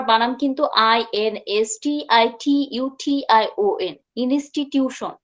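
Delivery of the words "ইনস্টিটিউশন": ordinary delivery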